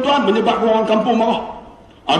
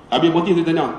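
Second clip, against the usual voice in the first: ordinary talk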